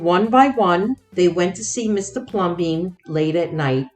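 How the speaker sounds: plain reading voice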